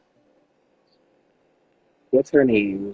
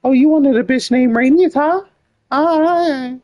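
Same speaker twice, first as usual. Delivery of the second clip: high, louder mock husband taunting; shrill taunt at the wife, hammed up; acting out the husband; 4 dB louder than usual. Husband mocking his wife, high and loud. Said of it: "He said".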